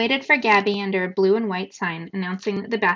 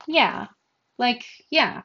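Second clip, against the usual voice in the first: relieved yeah; 4 semitones higher, much slower than usual. Acted. Yasmani's sudden relieved high yeah.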